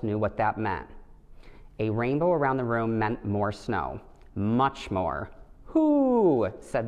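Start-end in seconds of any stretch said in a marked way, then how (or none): 5.7-6.5 a loud, very high hoot like an owl. A narrator's reading. The wise owl hoots that more snow is coming, loud high hoot.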